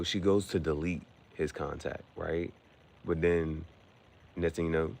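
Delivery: ordinary talk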